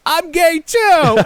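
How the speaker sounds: high, loud, drawn-out mock cry; hammed-up joyful declaration; gleeful announcement. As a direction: shout it out as a toy figure. Imagined action figure's happy confession.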